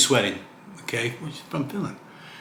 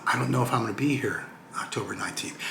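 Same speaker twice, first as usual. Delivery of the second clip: whisper; 8 dB quieter than usual. Retold or imitated. Quoting own worried reply.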